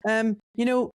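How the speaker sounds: high-pitched mock politeness; imitated evasive director. Director awkwardly ending the call, acted.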